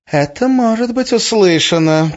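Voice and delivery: high, eerie mutter carried loud; muttering woman. A narrator's reading. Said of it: "пробормотала Арина". (бормотание высоким голосом)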